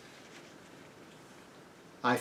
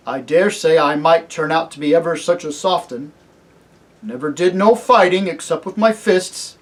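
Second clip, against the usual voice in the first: loud, higher working man's voice; humble hesitant cabbie; 4 semitones higher, 9 dB louder than usual. Acted. Cabbie doubting his courage in war, humbly earnest. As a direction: think it out honestly, modestly.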